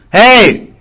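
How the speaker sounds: high, loud shout to someone far away; calling out. Shown as a demonstration. Calling to someone far away, loud shout. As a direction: yell to catch a distant person's attention. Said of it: "you want to get their attention".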